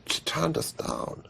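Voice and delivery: slow, hushed whisper. Retold or imitated. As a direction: breathe it out as bad news. Telling Ridley the bad news.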